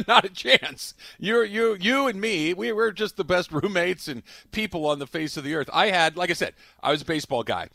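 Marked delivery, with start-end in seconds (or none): none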